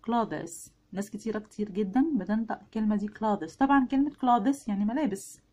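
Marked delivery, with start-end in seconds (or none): 0.0-0.7 "كلادس" said as two syllables, kla-des; common mispronunciation. Shown as a demonstration. Teacher shows learners the common mistake, mispronounced "كلادس". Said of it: "النطق الشائع الغلط كلادس". 3.1-3.5 "كلادس" said as two syllables, kla-des; common mispronunciation. Shown as a demonstration. Teacher shows learners the common mistake, mispronounced "كلادس". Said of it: "النطق الشائع الغلط".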